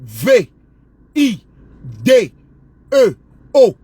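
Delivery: V I D E O letter by letter; spelling it out. Shown as a demonstration. Teaching a rival to spell vidéo, letter by letter. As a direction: spell each letter with a pause. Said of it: "Répète après moi".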